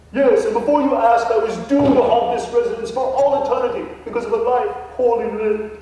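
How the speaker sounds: hammed-up English gentleman ghost; mock English accent. Ghost Nigel answers the realtor in a fake English accent. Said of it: "sounded more like that of a Texan trying to emulate an Englishman".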